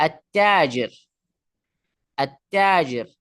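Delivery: "আত-তাজির" with no l, straight into a doubled t; teacher's demo of "আত-তাজির", lam silent. Shown as a demonstration. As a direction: say "আত-তাজির" holding the t, lam dropped. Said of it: "লামটা উচ্চারণ হয় না".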